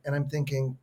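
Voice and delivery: ordinary delivery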